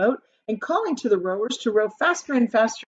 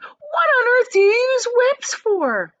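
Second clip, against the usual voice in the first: very high, loud, slow indignant demand; Veruca outraged; 7 dB louder than usual. A narrator's reading. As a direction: demand it like a spoiled brat.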